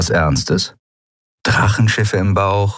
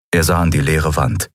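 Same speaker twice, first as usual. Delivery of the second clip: ordinary delivery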